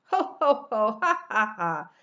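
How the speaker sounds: loud teasing ho ho ha ha; loud jeering laughter; mocking laughter. A narrator's reading. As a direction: laugh at him as you dance off. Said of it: "laughed the two lazy little pigs".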